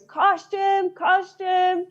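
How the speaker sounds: high, ringing call of costume, twice; retold call for costume. Matt calls for costume after his trousers split, high call. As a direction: call out for the costume team from across the set. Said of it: "all I could hear was".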